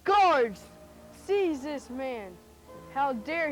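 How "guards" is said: loud call for the guards; shouting. Acted. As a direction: bark the order to the guards. Calls guards to seize man.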